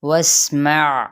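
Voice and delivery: واسمع very slow, with a whistling sin and a clear ain; slow tajweed demo. Shown as a demonstration. Teacher models واسمع for students, whistled sin and distinct ain. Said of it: "عین کو بھی نکالنا ہے".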